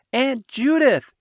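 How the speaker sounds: high, loud cry of her name, close to tears; almost crying. A narrator's reading. Boy who broke something, crying out to his aunt in alarm. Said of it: "almost crying".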